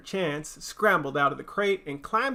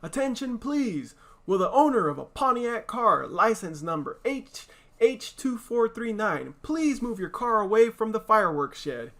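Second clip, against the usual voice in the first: a higher booming public-address voice; loudspeaker announcement; 7 semitones higher than usual. Acted. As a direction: call it out like a fair announcer. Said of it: "An enormous voice over the loudspeaker said".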